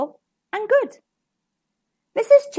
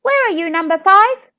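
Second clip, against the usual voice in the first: higher, louder doorstep call; raised, searching mother's call; 7 semitones higher, 7 dB louder than usual. A narrator's reading. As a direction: call for your missing child. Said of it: "stood on her doorstep calling".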